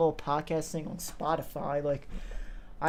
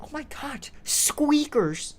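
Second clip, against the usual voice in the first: higher-pitched exclamation in mock reaction; mock reaction to squeakers; 10 semitones higher, slower than usual. Friends imagine reacting to their old videos, mock reaction. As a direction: act out spotting high-voiced kids, pitched up. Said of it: "we're gonna be like".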